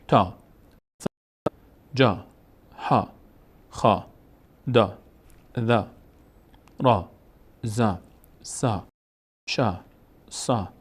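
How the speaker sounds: each syllable from تا to ها alone, on a long open "aa"; long aa drill. Shown as a demonstration. Teacher drilling Arabic letters with alef, long aa as in تا. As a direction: recite تا، جا، ها one by one, pausing between.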